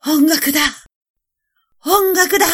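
high, loud cry for help; shouting. A narrator's reading. Emperor crying out for music against the faces, shouted. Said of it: "皇帝は叫びました".

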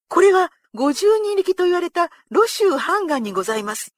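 high, loud outcry from a captive; startled cry. A narrator's reading. Captive identifying the slain general to his captors, crying out. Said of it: "飛び上がって叫んだ".

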